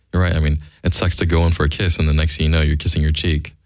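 ordinary talk, nothing marked

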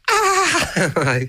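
very high mock scream; retold scream. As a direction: shriek it like a scared mark. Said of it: "elbow together scream 'cause the Mark went".

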